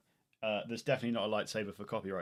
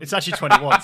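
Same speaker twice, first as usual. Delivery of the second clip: excited relief; 5 semitones higher, 15 dB louder, much faster than usual. Real, not acted. Relief at the corrected roll.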